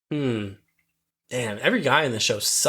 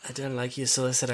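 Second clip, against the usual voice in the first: put-on softer Irish accent, menacing; a hushed Irish threat; 5 dB quieter, faster than usual. Quoting the Irish robber again, softly, in an Irish accent. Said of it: "pretty good Irish accent".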